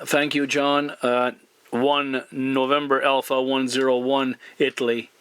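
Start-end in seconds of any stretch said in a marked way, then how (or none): none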